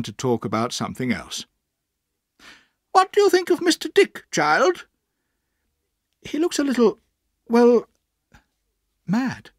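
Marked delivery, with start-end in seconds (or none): 2.9-4.9 very high, loud inquiry; imperious aunt quizzing a child; questioning aunt. A narrator's reading. 6.2-9.5 high voice, halting; tentative child, pitched high; hesitant boy. A narrator's reading.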